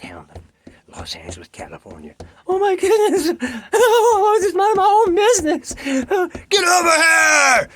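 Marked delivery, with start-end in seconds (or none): 2.5-5.7 laughing panic. Acted. Teenager fleeing through Los Angeles. 5.9-7.7 angry yell. Acted. Dudes chasing the teenager.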